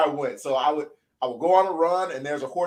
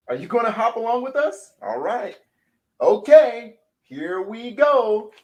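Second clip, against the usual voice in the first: excited invitation; 8 semitones higher, 4 dB louder than usual. Real, not acted. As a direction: come on, let's go. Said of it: "coaxing kids to join".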